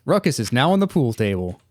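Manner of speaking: ordinary talk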